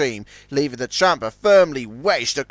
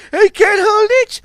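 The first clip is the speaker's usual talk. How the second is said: high, loud panicked cry; 8 dB louder than usual. A narrator's reading. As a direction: cry out as it slips away. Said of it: "cried Zug".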